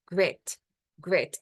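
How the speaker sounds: grit, short i that sounds near an e; grit almost with an e. Shown as a demonstration. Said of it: "quase como se fosse um E".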